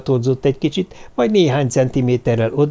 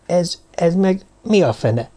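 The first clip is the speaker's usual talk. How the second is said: slow, higher, halting question; taken aback; 7 semitones higher, slower than usual. A narrator's reading. Doctor faces blackness behind the canvas, taken aback. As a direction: stammer it in startled disbelief.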